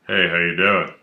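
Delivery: low, easygoing friendly hello; friendly cop greeting. Retold or imitated. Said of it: "he doesn't really say that".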